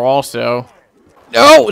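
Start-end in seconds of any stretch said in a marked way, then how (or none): 1.3-1.7 sharp, high, loud outburst; alarmed shout. Real, not acted. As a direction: cry out in sudden alarm. Alarm in the game.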